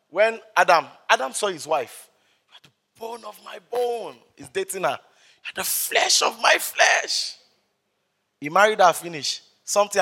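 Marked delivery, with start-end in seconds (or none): 2.5-4.2 lightly acted lovestruck Adam, softer; a hint of a swooning bridegroom; smitten Adam. Acts Adam seeing his wife. 5.0-7.4 high, slow, airy mock rapture; hammed-up adoration; enraptured Adam. Acts Adam enraptured by his wife.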